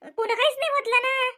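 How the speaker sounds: high, loud pleading cry; desperate shout. Acted. Wife shouts at husband and mother-in-law to stop, desperate. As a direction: shout them into silence.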